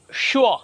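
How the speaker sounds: "শুয়া" ending on an open vowel, shu-o; silent R. Shown as a demonstration. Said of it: "আর উচ্চারিত হয় না।". ("শুয়া"-তে র অনুচ্চারিত)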